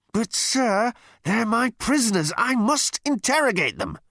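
high, insistent underling; frustrated protest. A narrator's reading. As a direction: protest up high to your superior. Frustrated officer protests to captain.

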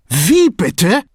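high, loud, outraged question; agitated. A narrator's reading. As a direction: burst out in indignant disbelief. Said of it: "fragte er aufgebracht".

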